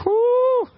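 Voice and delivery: very high, loud celebratory whoop; exuberant whoop. Acted. Pastor celebrating going full-time, exuberant whoop. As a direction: let out a piercing "whoo" of triumph. Celebrates raise to modest salary.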